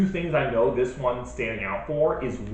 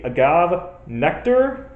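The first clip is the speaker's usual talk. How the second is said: ordinary talk, nothing marked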